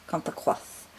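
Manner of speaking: whispered, in a hammed-up French accent, "comes across" said as "cahn acwoss"; mock French whisper. To her viewers, drifting off-topic, a mock French whisper. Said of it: "I've gone into a strange French accent".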